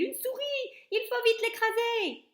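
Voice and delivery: high, loud frantic cry; shout. A narrator's reading. Cook alerting the kitchen, high loud cry. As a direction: yell it as the mouse lands. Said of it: "criait-il".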